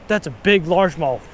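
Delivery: ordinary talk, nothing marked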